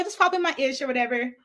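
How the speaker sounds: ordinary delivery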